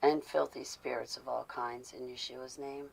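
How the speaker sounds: hushed, intense prayer binding spirits; whispered prayer. Acted.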